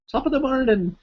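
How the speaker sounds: high-pitched bad Irish greeting; terrible Irish accent. Streamer greeting a viewer from Ireland, terrible Irish accent. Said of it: "that was terrible Irish accent".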